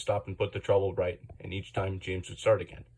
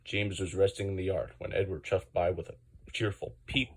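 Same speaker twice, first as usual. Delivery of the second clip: ordinary delivery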